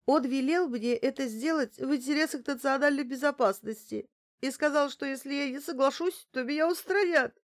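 high, distraught confession building to a near-sob; panicky, tearful high voice; frightened, near tears. A narrator's reading. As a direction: plead as if about to burst out crying. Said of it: "В конце фразы оператор чуть не разрыдалась".

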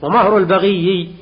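البغي with long doubled yaa, slow. Shown as a demonstration. Teacher showing the doubled letter to class, slow. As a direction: draw out the ي in البغي slowly. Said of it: "الياء مشددة".

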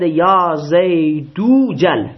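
یا زیدو جل slow and loud, long "doo" before "jal"; linked u reading. Shown as a demonstration. Teacher demonstrating the joined reading, زیدو جل run together with a long oo. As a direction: stretch it as "ya Zay-DOO-jal", hamza dropped.

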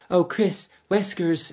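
high-pitched mock game heroine, trailing away. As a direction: imitate a badly acted line fading off. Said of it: "and she trails off".

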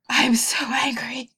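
whispered, slow fake anger; breathy whisper, no real anger; weak throaty angry. Shown as a demonstration. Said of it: "we got, you know, an actual angry voice".

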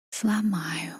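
soft stubborn whisper. A narrator's reading. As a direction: whisper it insistently. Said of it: "шептал".

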